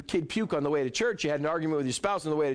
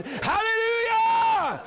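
very high, drawn-out shouted hallelujah; ecstatic hallelujah; 6 dB louder, much slower than usual. Acted. Preacher at the peak of praise, long soaring shout. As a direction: let it ring out to the rafters.

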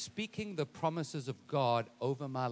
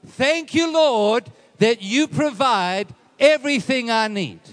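high, loud shouted thanks to God; full-force declaration; 8 semitones higher, 13 dB louder, slower than usual. Shown as a demonstration. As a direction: proclaim it at the peak of a sermon. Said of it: "say it like this".

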